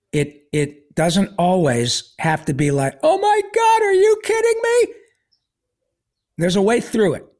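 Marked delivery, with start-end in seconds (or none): 3.0-4.9 very high-pitched mock freak-out; mock panic. Patient overreacting to bad news, in high mock panic. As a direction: wail it in exaggerated disbelief, way up high.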